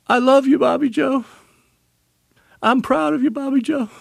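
high, pointed mock-loving words; dying father's mischievous jab, high and teasing; mock tender declaration. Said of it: "old humor and old malice at work".

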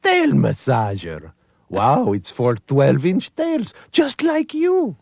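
very high, slow Eastern European coaxing; dog's Eastern European voice. Acted. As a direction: lure the tail over in a sweet, high Eastern European accent. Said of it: "and it says".

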